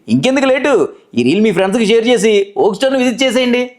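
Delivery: high, energetic sales-reel read; store ad voice-over. Shown as a demonstration. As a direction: pitch the offers like an excited shop ad. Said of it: "ఓక్ స్టోర్కి చేసిన voice నేను చేసి వినిపిస్తాను". (ఉత్సాహంగా shop ప్రకటన voice over)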